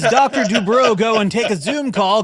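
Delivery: loud, high mocking jab; mock indignation. Host mocking the doctor on Zoom in mock outrage. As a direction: scoff at the surgeon's excuse.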